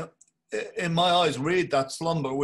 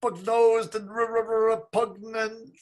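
stammering fright; 8 semitones higher than usual. Acted. Bloom stammers in shame.

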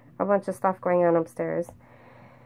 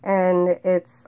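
normal talking voice

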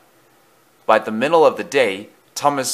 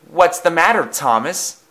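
higher-pitched call to a friend; 7 semitones higher than usual. A narrator's reading. James calls to Thomas as he stops, calling out. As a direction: call over to him brightly raised in pitch. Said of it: "he called as he stopped".

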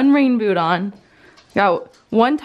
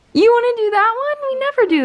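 surprised; 14 semitones higher, faster than usual. Real, not acted. Excited gasp at book choice.

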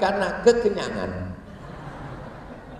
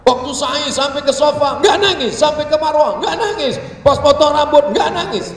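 loud, high, insistent recital; exasperated listing; 8 semitones higher, 9 dB louder than usual. Acted. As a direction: hammer out each failure in disbelief. Mock exasperation at a man never crying. (lantang dan tinggi, gemas)

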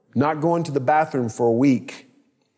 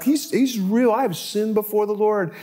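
much higher, hurried retold confession; panicked confession; 7 semitones higher than usual. David confessing before the frightened court in a high anxious cry.

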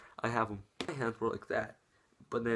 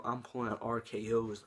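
ordinary talk

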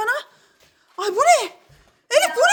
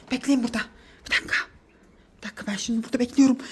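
whispering; 11 semitones lower, 6 dB quieter, much faster than usual. Real, not acted. Waiting hidden behind the door.

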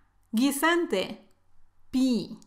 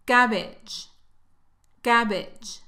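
ordinary talk, nothing marked

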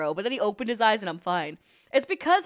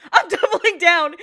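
laughing; 6 semitones higher, 8 dB louder than usual. Real, not acted. Laughing, repeating line louder.

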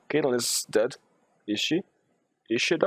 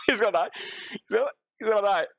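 high voice between bursts of laughter; amused, high-pitched commentary; laughing disbelief; 13 semitones higher, much faster than usual. Real, not acted. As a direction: squeak it out while cracking up. Laughing at the absurd fight.

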